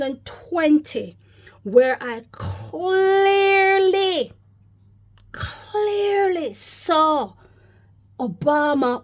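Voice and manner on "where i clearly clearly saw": high, very slow and drawn out, leaning on clearly; dramatic emphasis. Real, not acted. Preacher telling his followers about his vision, slow and stretched. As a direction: stretch each word as if reliving the vision.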